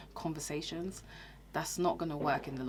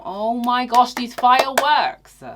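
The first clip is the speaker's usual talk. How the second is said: real exclamation, much louder and higher; startled outburst; 7 semitones higher, 15 dB louder, slower than usual. Real, not acted. Startled by fireworks outside, loud high exclaim. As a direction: burst out over the bangs.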